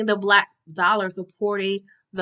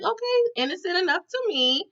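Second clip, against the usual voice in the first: high mock innocent; 8 semitones higher, 4 dB quieter than usual. Narrator reacting to the restaurant owner.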